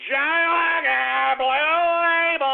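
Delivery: long, high hollered flourish; drawn-out holler. Real, not acted. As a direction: stretch every word out in a triumphant cry. Hailing his last shot.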